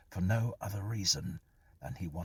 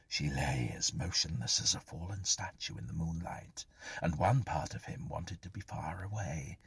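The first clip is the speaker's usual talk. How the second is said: ordinary delivery, nothing marked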